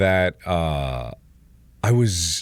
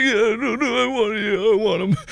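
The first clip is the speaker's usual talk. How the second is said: high, pitiful mock whimper; very sad imitation; 11 semitones higher than usual. Kid admitting to dad the pain is bad, mock sad. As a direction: plead sadly, acting out your younger self. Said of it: "very sadly going like".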